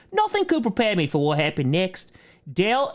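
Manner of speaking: mock British reading voice; British accent read aloud. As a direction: read it like a hammed-up Brit. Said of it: "I'll read some of it in a British ac".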